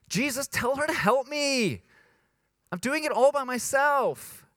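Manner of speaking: high-pitched tattletale whine; hammed-up, aggrieved high whine; whiny. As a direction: whine like a kid yelling for mom. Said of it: "it's kinda whiny".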